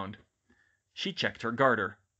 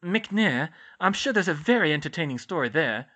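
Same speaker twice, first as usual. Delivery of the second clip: dry sarcasm, raised in pitch; sarcastic; 6 semitones higher, 6 dB louder than usual. A narrator's reading. Nurse doubting Hermione's wand story, sarcastic. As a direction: lay on a touch of mock interest. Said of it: "Pomfrey said sarcastically".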